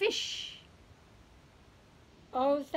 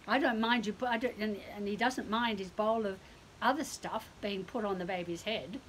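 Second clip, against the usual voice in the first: plain reading voice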